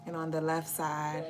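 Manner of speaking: slow soft meditation voice; guided meditation. Acted. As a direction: guide them gently into calm. Leads a meditation.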